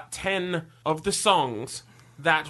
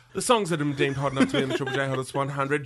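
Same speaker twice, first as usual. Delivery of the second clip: rattled off quickly, lower; fast spoken; 5 semitones lower, much faster than usual. Shown as a demonstration. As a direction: rush through it. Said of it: "spoken fast take".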